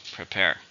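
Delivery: "Prepare" with the stress on its second syllable. Shown as a demonstration. Teacher modelling word stress for learners, "Prepare" stressed on the second syllable. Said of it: "stressed in the second".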